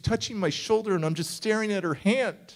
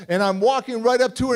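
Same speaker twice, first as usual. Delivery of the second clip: normal talking voice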